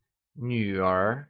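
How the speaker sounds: "女儿" drawn out, dipping low then rising; falling-rising tone. Shown as a demonstration. Teacher models the word for daughter, slow "女儿" with falling-rising tone. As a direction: dip down and climb back up on "女儿", slowly. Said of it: "pronounced using the falling rising tone".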